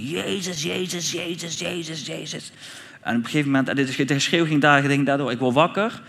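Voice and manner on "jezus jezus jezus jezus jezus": held-back scream, raised pitch without volume; mock scream. Man retelling how he began screaming to Jesus, restrained mock scream. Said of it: "ik begin te schreeuwen".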